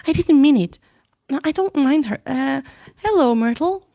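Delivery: nervous cover-up; flustered. Acted. Hermione caught out, flustered and loud.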